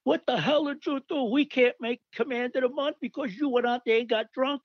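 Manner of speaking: hammed-up angry captain bawling him out; retold captain's scream. Captain yelling at the drunk private for costing the unit. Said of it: "the captain's screaming".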